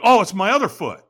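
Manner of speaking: loud, high exclamation; sudden realization. Retold or imitated. Firewalker checking his feet for burns, loud surprised blurt. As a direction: blurt out the discovery. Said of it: "I'm like".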